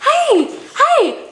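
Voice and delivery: squealed high, loud greeting; excited high hey. Acted. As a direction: shout hey like bursting into the room. Playful loud greeting to camera.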